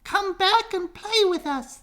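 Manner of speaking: very high, loud calling. Acted. Ducklings calling from the shore, very high and loud. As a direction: call across the pond. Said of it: "they called".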